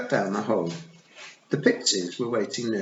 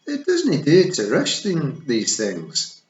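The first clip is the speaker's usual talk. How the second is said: Scottish Feegle; 6 semitones higher, 7 dB louder, slower than usual. A narrator's reading. Feegle voice, sly.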